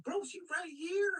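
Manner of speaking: high-pitched incredulous correction; mock exasperation. Soldiers showing Mark that Cleopatra lives, mock exasperation. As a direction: squeak it as if he missed the obvious.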